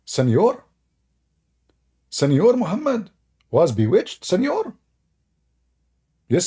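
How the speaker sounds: high put-on questioning voice; hammed-up high Spanish-flavoured questions; mock Senor voice. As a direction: play a doubtful listener asking Senor.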